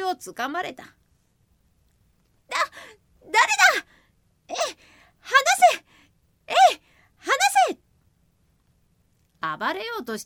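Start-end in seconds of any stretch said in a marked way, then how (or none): 2.5-7.8 high, loud panicked yelling; panicked shout. Acted. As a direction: yell in a panic, struggling. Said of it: "とわめくと". (高く大声でわめく雷小僧)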